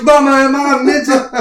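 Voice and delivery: put-on high, loud little kid's plea; little boy asking. Small boy running to his mother asking, high and loud. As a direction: whine it up to Mama like a small boy. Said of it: "And he goes".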